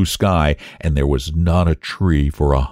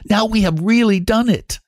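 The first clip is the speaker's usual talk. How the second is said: put-on triumphant boy. A narrator's reading. Boy triumphant after dragon trapped.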